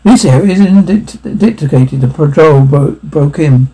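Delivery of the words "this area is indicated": usual speaking voice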